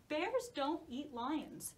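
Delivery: ordinary delivery, nothing marked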